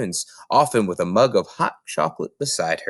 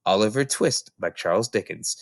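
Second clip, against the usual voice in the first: ordinary delivery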